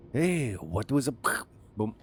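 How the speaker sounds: slow, put-on French accent. Sierra one hearing the boom, mock French accent. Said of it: "I did it with a French accent".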